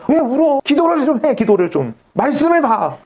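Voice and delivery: high-pitched mock scolding; 다그치는 말투. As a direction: badger a crying person with pushy advice, acted out. 우는 사람을 다그치던 옛 자신. (우는 사람을 높은 소리로 다그치는 흉내)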